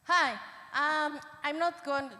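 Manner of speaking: loud, sharp classroom voice; teacher voice. Real, not acted. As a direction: project it like addressing a class. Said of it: "It's my teacher voice".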